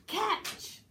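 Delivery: high, sharp "Catch!"; sudden cry. Shown as a demonstration. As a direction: cut in with a high call. Director demonstrates a sudden interrupting cry.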